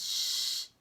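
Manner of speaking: a whispered shush; hushing shh. Acted. Grover hushing the reader, whispered. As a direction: hush the listeners like a secret. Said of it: "He says".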